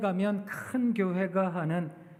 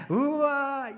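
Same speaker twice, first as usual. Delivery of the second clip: mock wow; 6 semitones higher, 3 dB louder, much slower than usual. Exclaiming at family's love.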